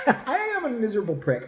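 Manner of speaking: says it through a laugh, mocking himself. Real, not acted. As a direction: laugh it out at his own expense. Self-mocking confession.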